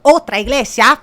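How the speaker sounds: hammed-up tour pitch, building to a loud finish; showy sales pitch. New guide showing tourists the poster, showy pitch. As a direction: present each sight, then belt out the finale.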